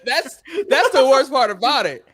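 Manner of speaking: laughing while talking. Real, not acted. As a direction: talk through the laughter. Laughing at [name]'s banana joke.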